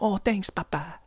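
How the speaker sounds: put-on high, odd child voice with a weird accent; thanking Papa in a funny kid voice. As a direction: lightly voice a strange little boy. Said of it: "why my kid sounds like that".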